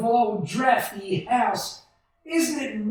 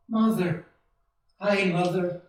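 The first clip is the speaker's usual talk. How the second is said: usual speaking voice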